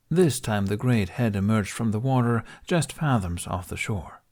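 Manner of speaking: ordinary delivery, nothing marked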